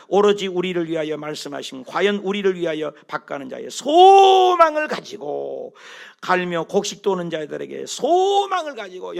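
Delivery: slow, loud unison reading; scripture reading. Acted. Preacher leading the congregation in scripture, loud and slow. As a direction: read it out slowly for the congregation.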